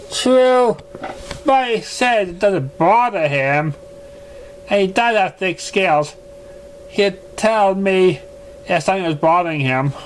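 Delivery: a refined mare, gently defensive; Rarity-like lady. Acted. Narrator voices Rarity character.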